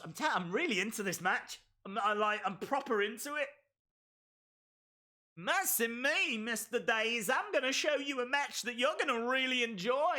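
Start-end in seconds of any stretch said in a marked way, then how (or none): 5.4-10.2 badly faked Southern belle accent. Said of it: "That was such a bad accent".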